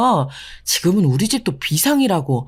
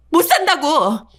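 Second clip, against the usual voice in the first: angry shout; 6 semitones higher, slower than usual. Acted. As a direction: yell it out, at the end of your rope. Wife's outburst at husband. (남편에게 소리치는 아내)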